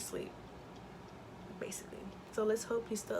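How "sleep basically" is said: whisper. Real, not acted. Baby falling asleep nearby.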